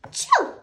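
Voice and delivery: sudden high loud sneeze burst; big sneeze. Acted. Gerald sneezes in front of Piggie, loud high sneeze. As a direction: blast out a sneeze.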